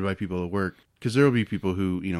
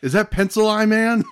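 mock awe; 12 semitones higher, 5 dB louder than usual.

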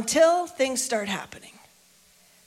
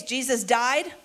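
ordinary delivery

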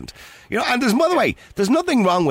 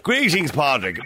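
lightly imitating the caller's greeting, higher pitch; mimicked greeting; 6 semitones higher, slower than usual. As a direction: echo his signature hello in mimicry. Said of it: "he'll start his conversation with greetings".

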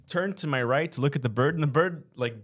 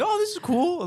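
high-pitched fake enthusiasm; mock delighted. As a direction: sing out a fake "oh cool". Mock delighted quote of himself.

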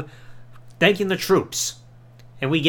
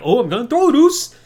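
teasing call, rising louder and higher; mock shout to partner; 6 semitones higher, 6 dB louder than usual. [name] teasing Darren, calling out to his brother in mock excitement. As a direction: fake a throw while calling to Deuce. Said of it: "He's like".

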